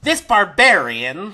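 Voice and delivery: high, slow stage announcement; gnome showman. Acted. Gnome carries on presenting the fighter, high showman's voice. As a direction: draw it out grandly for the crowd.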